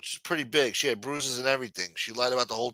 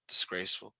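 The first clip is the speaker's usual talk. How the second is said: usual speaking voice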